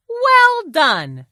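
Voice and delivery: high, loud congratulation; delighted praise. Acted. Fox praises the ogres' manners, delighted praise. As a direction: cheer their success with a big swoop.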